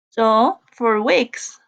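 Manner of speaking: teacher pointing at "for weeks"; slow stress on weeks. Shown as a demonstration. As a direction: stretch out "weeks" slowly as the clue word. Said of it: "We are emphasizing on the time".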